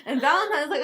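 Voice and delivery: usual speaking voice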